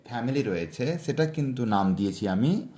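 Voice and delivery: ordinary talk, nothing marked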